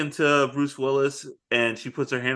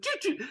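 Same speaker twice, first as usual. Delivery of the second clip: high, soft engine sputter; sputtering engine noise; 8 dB quieter than usual. Retold or imitated. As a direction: imitate a dying engine. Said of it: "it's just like".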